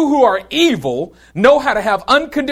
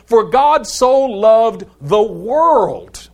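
preacher stretching a famous verse; drawn-out scripture quote; 2 semitones higher, much slower than usual. Acted. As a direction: lean into each word as if proclaiming it. Quoting scripture with emphasis.

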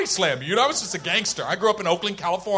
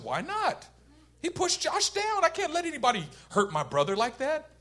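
high, soft imitation of indignant kid; childish protest; 6 semitones higher, 6 dB quieter than usual. Boy defending himself to mom, high protesting voice. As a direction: plead your case in a raised kid voice.

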